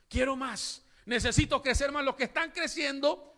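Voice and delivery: high, loud preaching build; fervent preaching. Acted. Preacher urging leaders to hunger for God, fervent. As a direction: lift the congregation with rising fervor. Preacher rising to full force.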